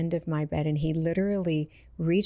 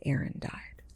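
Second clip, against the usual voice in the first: whispered, much softer disbelief; stunned whisper; 7 dB quieter than usual. Retold or imitated. Father hearing his son died, stunned whisper. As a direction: breathe it out in shock. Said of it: "And he said".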